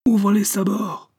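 high, drawn-out battle order; shouted command. A narrator's reading. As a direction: bellow the order slowly across the ship. Battle order called out to crew.

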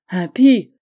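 loud, sharp cry of outrage; furious outcry. A narrator's reading. Old chief denounces his nephew's oath with a loud cry. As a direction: cut him off with a shout. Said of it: "s'écria Dario".

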